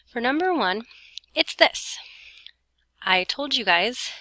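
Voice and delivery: normal talking voice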